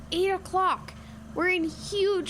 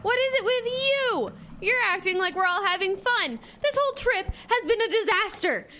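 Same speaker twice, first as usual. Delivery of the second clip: frustrated outburst; 7 semitones higher than usual. Acted. Angry outburst at Riley.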